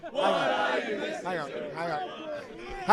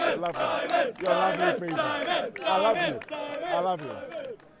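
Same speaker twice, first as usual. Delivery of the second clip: crowd calls; 7 semitones higher, slower than usual. Real, not acted. Crowd shouting affection.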